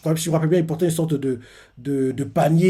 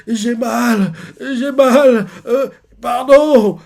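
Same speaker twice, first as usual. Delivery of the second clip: high, louder pained wail, slowing down; pained crying; 8 semitones higher, 5 dB louder, much slower than usual. Retold or imitated. Young Kuma crying out as the men beat him. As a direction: cry out in pain and plead.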